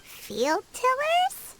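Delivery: slow, questioning repeat rising at the end; puzzled echo. Acted. Companion hears an odd name for machines, puzzled echo. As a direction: echo it as if baffled.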